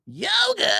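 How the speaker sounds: very high, strained squeal; mock high-pitched amazement. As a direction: imitate an astonished rocker shrieking. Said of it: "That's my impression of you doing".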